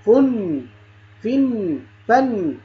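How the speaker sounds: "فن، فن" as funn, then finn; "فن، فن" with long hummed final n; tanween drill. Shown as a demonstration. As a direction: say "فن، فن" with u then i, each n held. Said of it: "ننطقها نون".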